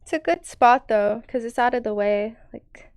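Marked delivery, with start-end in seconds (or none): 2.5-2.9 whispering. Real, not acted. Whispered musing.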